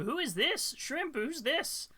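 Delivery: slow, high cooing. Real, not acted. Cooing over cat photo.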